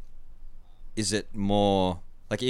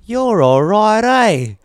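high, loud hammed-up Aussie accent; most Australian accent; 13 semitones higher, 10 dB louder, much slower than usual. Auntie turning to the Australian girlfriend, exaggerated Aussie accent. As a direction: imitate auntie going absurdly Australian. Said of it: "Australian accent ever".

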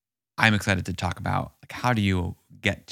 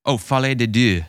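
French accent; 5 semitones higher, 6 dB louder than usual. Real, not acted. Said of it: "Pardon my terrible French accent".

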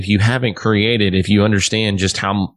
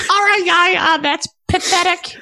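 much higher, mocking put-on voice of the drummer. Musician mocking a bandmate's riff in a high caricature voice. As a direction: sneer it out in a squeaky caricature. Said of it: "I'm now [name]".

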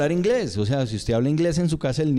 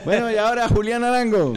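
higher-pitched stage introduction; mock show announcer; 8 semitones higher than usual. Comedian mimics the host introducing him after the hit, announcing. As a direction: call the next act to the stage.

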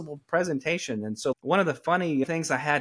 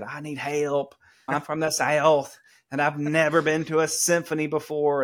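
put-on laid-on Southern accent. Student begging music teacher for help, laid-on Southern accent. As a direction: pour on the Southern accent as you plead. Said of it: "poured on my Southern accent".

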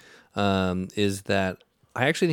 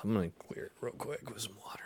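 whispering; 11 dB quieter, faster than usual. Real, not acted. Aside while clearing mouth.

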